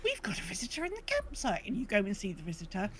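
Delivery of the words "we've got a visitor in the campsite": high, excited gasping announcement; breathless in-game morning announcement; Isabel. Acted. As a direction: put on a perky, high-pitched character voice. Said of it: "Isabel in the morning goes".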